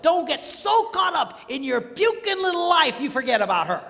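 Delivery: high and loud preaching; fiery preaching, loud and high; preaching at full force. Real, not acted. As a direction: keep the fire going.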